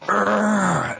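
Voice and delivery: high put-on roar; mock monster growl. Mother in the sitcom, mock Godzilla roar. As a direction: Lucille joining in with a silly roar. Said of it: "Lucille walks in and she goes".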